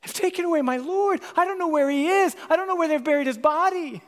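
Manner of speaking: high, loud, pleading grief; Mary at the empty tomb, desperate and loud; distraught cry. Retold or imitated. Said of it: "she's weeping".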